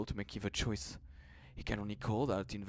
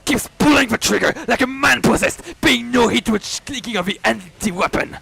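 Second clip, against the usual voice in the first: loud, driving horror telling; frantic climax narration; 11 semitones higher, 14 dB louder than usual. A narrator's reading. Narrator racing through Koji's terror, loud and frantic. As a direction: push the panic through every line.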